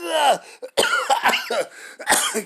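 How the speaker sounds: strained coughs, voice gone from the imitation; coughing after the mock shout. Real, not acted. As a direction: cough it out, throat raw from the yell. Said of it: "can't even talk like that dude".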